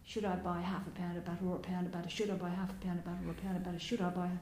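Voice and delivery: fast, rattled-off looping thought; racing mad thought. Retold or imitated. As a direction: gabble it like a mouse on a wheel. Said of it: "some sort of mad thought like".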